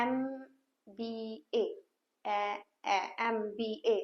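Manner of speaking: "एमबीए" very slow and soft, the opening e sound drawn out. Shown as a demonstration. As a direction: sound out the first letter. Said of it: "कौन सा आ रहा है?". ('एमबीए' का पहला 'ए' खींचकर धीरे बोलना)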